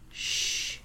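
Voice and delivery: soft voiceless "Shh"; shushing. Retold or imitated. Hiding horseman hushes the little girls with a soft shush. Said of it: "and he's like".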